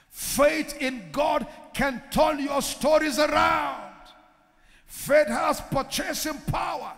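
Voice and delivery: rising, shouted preaching refrain; a preacher climbing to a shout; preaching at full force. Acted.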